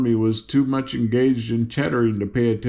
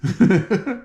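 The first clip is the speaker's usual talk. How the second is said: high amused laugh; reader's laugh; 8 semitones higher than usual. Real, not acted. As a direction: break into a short laugh. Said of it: "reader laughs".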